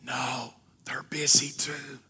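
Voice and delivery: put-on slow, secretive little boy whisper; child's whisper. Little boy whispering to the salesman on the phone. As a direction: breathe it like a kid hiding something. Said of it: "And the little voice said".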